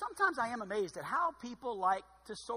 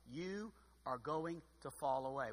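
soft, low, slow and intimate; quiet personal warning; 6 semitones lower, 10 dB quieter, slower than usual. Acted. Preacher voicing Jesus speaking to each listener, softly. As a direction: lean in and say it gently, slowly.

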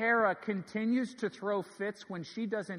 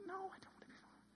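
put-on very high, very soft child voice; imitating a little girl's faint squeal; four-year-old daughter; 14 semitones higher, 20 dB quieter, much faster than usual. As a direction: protest in a tiny airy falsetto. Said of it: "my four-year-old".

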